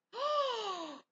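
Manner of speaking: high, loud gasp; sharp delighted intake of breath; excited gasp. Real, not acted. Grandma is making chicken and dumplings, excited gasp.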